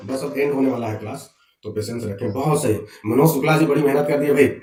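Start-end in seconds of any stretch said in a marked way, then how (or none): none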